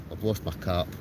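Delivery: ordinary delivery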